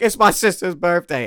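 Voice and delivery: high, loud protesting yell; re-enacted indignant outburst; retold yelling.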